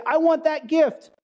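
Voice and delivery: mock-envious social media scroller; envious wanting. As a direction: whine like someone jealous of others' gifts. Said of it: "we say".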